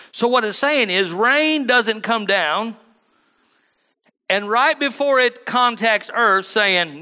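high, loud, slow hammering sermon climax; preaching at full force. Acted. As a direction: drive the point home to the congregation.

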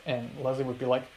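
ordinary delivery

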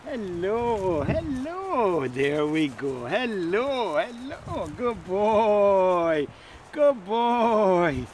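high, drawn-out sing-song praise; loads of praise. Shown as a demonstration. Trainer rewarding his dog's retrieve, effusive high praise. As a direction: gush over the dog with swooping, stretched words. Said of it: "And loads of praise".

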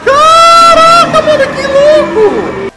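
slow, drawn-out, very high and loud; amazed shout. Real, not acted. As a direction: stretch it out in awe. Reacting to stolen power.

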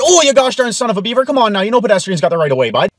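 loud fast folksy Canadian rant; mock angry yell. Pedestrian yelling at a careless driver, loud fast rant.

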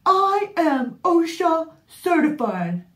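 high, loud, slow smug imitation; mocking imitation voice. Wife imitating husband's boast, high loud and slow. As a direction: puff up like a proud know-it-all. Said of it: "That's just my imitation voice".

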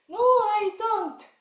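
very high, loud, drawn-out no; puppet child refusing. Pretend. Child puppet refusing vegetables, high and drawn out. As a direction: whine it out slowly like a stubborn kid.

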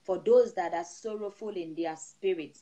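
steady, rhythmic prayer over the flock; prayerful blessing. Acted. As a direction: pray over the congregation with rising fervour. Pastor praying over the congregation.

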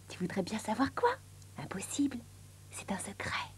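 secretive, teasing whisper; hushed conspiratorial aside. Acted. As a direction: whisper to the listener as if guarding a secret. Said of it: "lui chuchota quelque chose".

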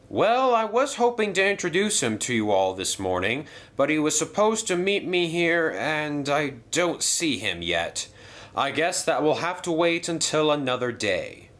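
hearty stately controller, pleased then serious; railway boss voice. Acted. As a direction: address the engines like a proud boss. Hatt waits for newcomer.

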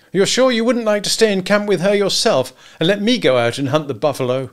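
high, loud, needling question; bitter sarcasm. A narrator's reading. Husband to hunter he hates, bitterly. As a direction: goad him about his wife.

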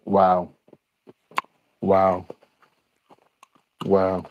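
normal talking voice

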